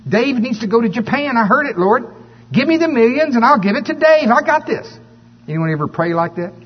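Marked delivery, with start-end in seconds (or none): 0.1-4.9 much higher, louder, hammed-up cocky prayer; overeager prayer. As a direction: pray like a know-it-all sure he has it all worked out. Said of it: "I was going".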